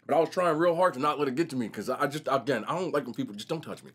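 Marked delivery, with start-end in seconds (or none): none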